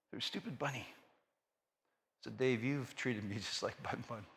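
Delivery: soft, low, searching voice; quiet voice of God. Retold or imitated. God speaks to Dave about the caged bunny, soft and low. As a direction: speak as God, gently rebuking.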